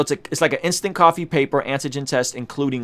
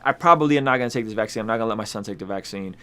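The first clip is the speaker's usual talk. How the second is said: ordinary talk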